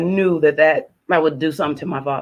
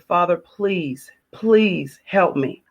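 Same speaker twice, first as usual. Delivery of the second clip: slow, pleading prayer under her breath; slow desperate prayer; much slower than usual. Retold or imitated. Woman recalling a gunpoint scare, praying to God slowly. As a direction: beg God slowly, each word weighed.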